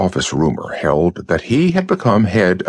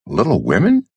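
a lightly voiced surprised question on "Little Women?"; incredulous echo; 3 semitones higher than usual. A narrator's reading. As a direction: repeat "Little Women?" as if you can't believe it. Burris echoes the title in disbelief.

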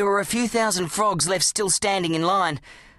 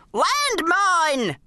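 yelling; 11 semitones higher than usual. A narrator's reading. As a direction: yell it as the barrow tips. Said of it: "he yelled".

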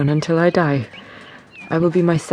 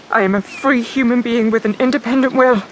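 defiant outburst; 7 semitones higher than usual. Acted. Jane refusing Rochester, defiant and raised. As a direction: fling your freedom at him.

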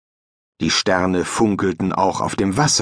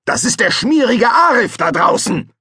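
high, loud thundering shout; 13 semitones higher, 4 dB louder than usual. Acted. Fester thunders about his rival to his son, high and loud. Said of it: "donnerte Fester".

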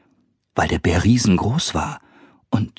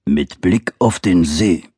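growling bear; 2 semitones lower, slower than usual. Acted. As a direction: rumble it like a big bear. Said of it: "brummte der Bär".

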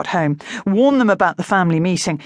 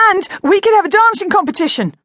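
much higher and louder; excited boy; 13 semitones higher, 5 dB louder, faster than usual. Acted. Harry proposing a contest, high and loud. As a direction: burst out with the idea.

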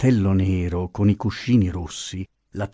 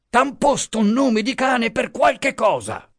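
high, biting scold; angry scolding; 14 semitones higher than usual. A narrator's reading. Pasotti scolding the boatman, angry high voice. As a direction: keep insulting him.

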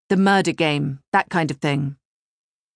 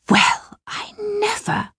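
much higher, slow breathless whisper of shock; astonished whisper; 13 semitones higher, slower than usual. Acted. Mother stunned by the murder notice, whispering in shock. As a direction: whisper it in disbelief.

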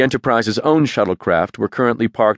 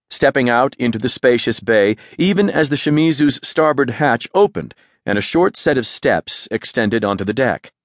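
plain reading voice

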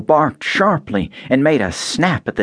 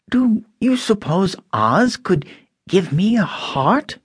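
higher, slow hopeful tin voice; wistful Tin Man; 10 semitones higher than usual. A narrator's reading. Tin Man asking Dorothy about Oz, hopeful. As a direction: ask hesitantly, longing for a heart.

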